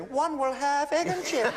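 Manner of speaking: posh royal, slow and lifted; Princess Anne voice. Retold or imitated. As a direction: play a haughty royal ordering food. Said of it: "going into the transport cafe and saying".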